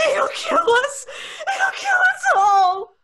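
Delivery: high, crying shriek; terrified shriek. Acted. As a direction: wail it in terror. Terror climax.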